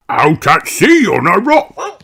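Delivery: put-on matter-of-fact old grandad; Grandad Dog. A narrator's reading. Grandad Dog answering Peppa, lightly voiced.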